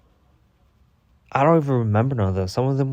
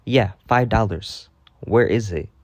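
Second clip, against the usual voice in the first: ordinary talk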